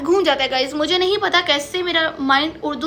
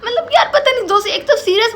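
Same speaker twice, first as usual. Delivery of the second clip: laughing; 5 semitones higher, 4 dB louder than usual. Real, not acted. Recalls laughing with Kiran.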